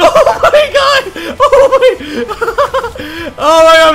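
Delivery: very high, loud squeal through laughter; shrieking with real laughter; hysterical laughing. Real, not acted. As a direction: crack up while gasping out the words. Hysterics during playful rampage.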